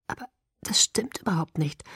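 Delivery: voiceless, insistent; urgent whisper. A narrator's reading. Bella protests to Edward in an urgent whisper.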